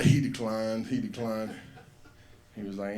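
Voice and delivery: ordinary talk, nothing marked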